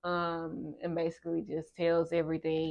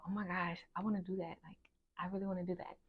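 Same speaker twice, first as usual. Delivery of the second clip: hushed inner voice, softer than the telling; thinking to oneself; 13 dB quieter, much faster than usual. Retold or imitated. Student at orientation privately longing to join in, thinking to oneself. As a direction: quietly voice a private thought. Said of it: "thinking to myself".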